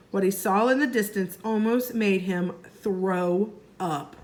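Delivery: plain reading voice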